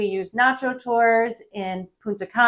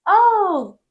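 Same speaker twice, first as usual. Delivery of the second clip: bright oh; 10 semitones higher, 5 dB louder than usual. Pretend. Tourist answering counts days.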